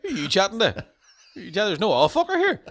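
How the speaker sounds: high, laughing Northern Irish movie quote; famous line hammed up in broad Belfast; local Northern Irish accent. Playing movie lines from here, high local accent. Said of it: "just famous movie lines, but they're from here".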